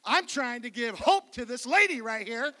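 mock furious yell out the car window; preacher yelling at an impatient driver behind him, hammed-up; pretend scream. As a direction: shout it at the honking driver, rising on "lady". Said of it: "so I screamed at him".

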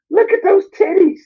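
very high falsetto exclamation. Retold or imitated. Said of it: "I remember [name] saying is".

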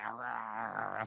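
low growling like a rabid dog. Retold or imitated. Preacher mimics an angry man foaming at the mouth, low growl. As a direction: snarl it out like he's foaming at the mouth. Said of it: "foaming at the mouth".

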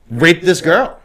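ordinary talk, nothing marked

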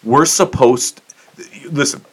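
ordinary talk

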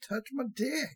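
high, silly wheedling voice; mock begging boyfriend; pestering boyfriend. Listener mimics pestering boyfriend.

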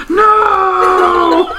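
high, loud, laughing cry; anguished shout. Retold or imitated. Kid realizing who the boyfriend is, shouting laughing. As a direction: yell it in horror. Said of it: "the little kid's like".

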